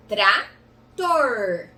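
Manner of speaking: slow "trator", split, long final R; syllables with held R. Shown as a demonstration. Teacher demonstrating the whole word, drawn-out R. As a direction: say tra, pause, then growl out "tor". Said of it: "então eu falo".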